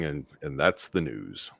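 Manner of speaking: slow, low newsman sign-off; a hint of Cronkite gravitas; Walter Cronkite. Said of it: "we can always do the Walter Cronkite thing".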